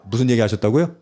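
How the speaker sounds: normal talking voice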